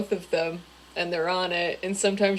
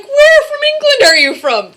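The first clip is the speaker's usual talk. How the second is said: high, loud voice imitating an inquisitive guy; mock curious stranger; 10 dB louder than usual. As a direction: exclaim the question as an excitable stranger. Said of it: "that guy was like".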